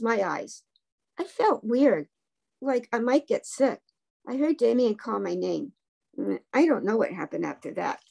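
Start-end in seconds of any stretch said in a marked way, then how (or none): none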